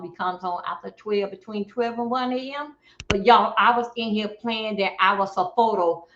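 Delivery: ordinary delivery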